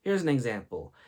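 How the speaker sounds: usual speaking voice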